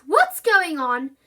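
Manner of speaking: loud, slow demand. A narrator's reading. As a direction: demand an answer. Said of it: "she demanded".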